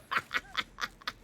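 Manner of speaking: breathy high witch cackle. Real, not acted. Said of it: "my witch cackle".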